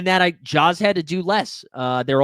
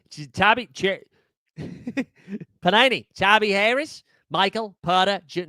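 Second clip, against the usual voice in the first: a second try at a put-on Australian accent, stumbling over names; hammed-up Aussie read, breaking into a laugh; 3 semitones higher than usual. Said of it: "One more time".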